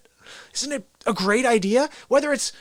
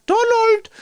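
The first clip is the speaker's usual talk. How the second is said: calling out; 14 semitones higher, 6 dB louder than usual. Retold or imitated. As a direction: yell the name across the house. Calling out for [name].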